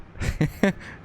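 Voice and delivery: short real laugh; amused chuckle. Real, not acted. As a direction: chuckle in happy disbelief. Amused at the crazy trucks.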